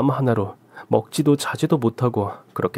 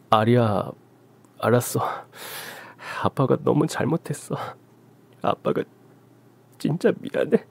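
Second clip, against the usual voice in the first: softer, choked apology to his daughter; father near tears apologizing softly; tearful apology; 4 dB quieter than usual. Acted. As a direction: hold back tears as you apologize.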